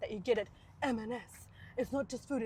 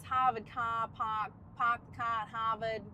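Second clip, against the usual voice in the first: slow R-less Boston demo; Boston accent; slower than usual. Shown as a demonstration. Showing what people call Boston accent, R-less. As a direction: drop the R's like a Bostonian. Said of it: "you must have that Boston accent".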